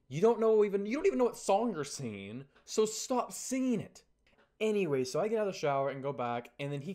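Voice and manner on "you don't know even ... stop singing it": loud, higher mock telling-off; exasperated scolding. Cabinmates tell Zach to stop singing, exasperated scolding. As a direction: re-enact fed-up complaint, pushed louder. Acted scolding of bad singer.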